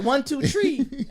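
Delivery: exaggerated mock Jamaican accent, drawn out and loud; Jasmine Guy's Jamaican accent. Co-host mocking the actress's accent on the show, exaggerated Jamaican accent. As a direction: stretch it out in a put-on Jamaican accent. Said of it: "in her bag on the accent".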